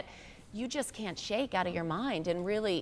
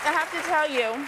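talking with mouth full; 5 semitones higher, 4 dB louder than usual. Real, not acted. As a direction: speak around a bite of food. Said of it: "excuse me for talking with my mouth full".

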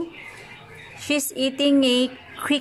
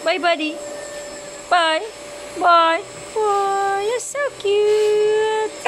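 squeaky praising a dog; 8 semitones higher, much slower than usual. Real, not acted. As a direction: coo at the dog.

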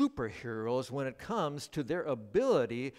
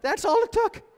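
put-on much higher, louder little kid voice; child's voice; 12 dB louder than usual. Sick little kid calling out in the night, high child voice. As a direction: squeak it like a small sick child. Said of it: "heard one of our kids go".

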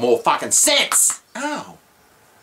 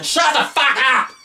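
shrill, much higher bird screech of words; screeching butcher bird; 10 semitones higher than usual. Retold or imitated. Imitating the birds screaming outside, bird screech. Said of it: "butcher birds out there screaming".

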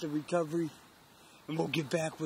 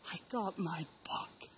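breathless whisper of disbelief; hushed, thrilled whisper in the tree stand; excited whisper; 3 semitones higher, 7 dB quieter than usual. Real, not acted. Hunter whispering after killing buck.

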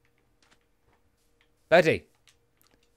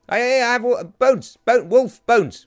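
loud, higher, repeated calls; urgent calling; 5 semitones higher, 6 dB louder than usual. Real, not acted. Player offering bones to wolves, urgent calling. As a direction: call out eagerly to get attention.

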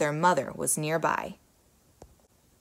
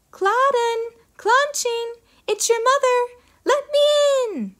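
very high, slow sugary ogress call; an ogress faking a sweet mother; sweet fake voice; 6 dB louder than usual. A narrator's reading. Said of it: "making her voice very sweet".

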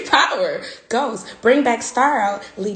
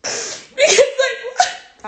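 fake crying; 14 semitones higher than usual. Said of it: "try to do this to cry on spot".

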